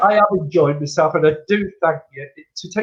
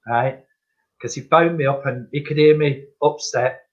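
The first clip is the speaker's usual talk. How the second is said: normal talking voice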